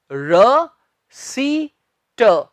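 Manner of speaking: र, सी, ट split apart with pauses; spelled out syllable by syllable. Shown as a demonstration. Teacher splitting रसीट into syllables for students. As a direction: break रसीट into र सी ट slowly. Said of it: "इसका सही उच्चारण है".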